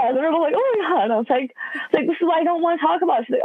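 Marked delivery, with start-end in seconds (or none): 0.6-1.0 high-pitched mock dismay; an acted, squeaky-high oh no. Daughter seeing mom start crying, retold dismay. 2.1-3.3 high, quick retold protest; exasperated protest. Daughter to mom after both started crying, mock exasperation.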